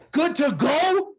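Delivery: very high, slow incredulous repeat; outraged echo. Retold or imitated. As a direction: throw his words back at him in disbelief. Sergeant major mocking the reply.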